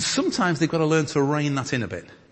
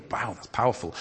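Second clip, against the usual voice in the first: ordinary delivery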